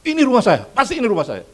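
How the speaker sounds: high, loud boastful insisting; loud smug "Ini rumah saya"; proud loud claim. Acted. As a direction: crow it like a man sure of his mansion. Proud pastor insists to angel.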